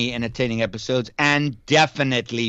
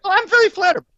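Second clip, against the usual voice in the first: much higher, louder, mock squeaky flattered voice; 6 dB louder, faster than usual. Host teases his cohost in a mock squeaky, flattered voice. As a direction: squeak it out like a giddy fan.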